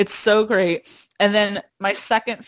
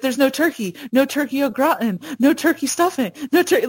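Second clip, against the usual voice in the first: higher, mock-upset kid's lament; Ralph; 5 semitones higher, faster than usual. Kid's voiceover mourning the ruined dinner, mock upset. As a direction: list each loss like an upset kid, pitched up. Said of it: "He's, like, so upset".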